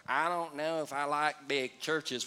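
low, slow mock grumble; reluctant churchgoer. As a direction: imitate a doubtful complainer, dragging it out. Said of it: "That's why when people go".

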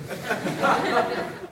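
breathy giggle before the quote; soft self-conscious laugh; embarrassed laugh. Real, not acted. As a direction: laugh it off, a bit sheepish. Laughs before mock accent reading.

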